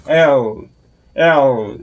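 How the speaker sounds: L with the tongue pushed out to the teeth; L sound demo. Shown as a demonstration. Teacher showing the English L sound, tongue forward. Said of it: "혀를 쭉 빼서 앞니 위까지".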